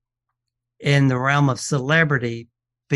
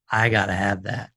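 quoting the collector; 4 semitones lower than usual. Retold or imitated. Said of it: "he goes".

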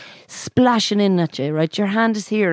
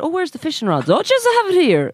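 high mock dialogue; 8 semitones higher than usual. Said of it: "I said".

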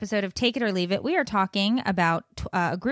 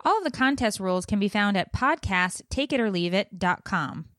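quick, bright promo read; super fast ad read. Acted. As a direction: rattle off the contest rules. Sponsor contest read.

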